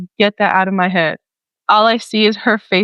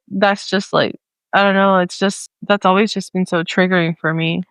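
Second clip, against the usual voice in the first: usual speaking voice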